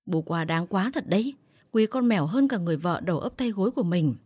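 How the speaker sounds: usual speaking voice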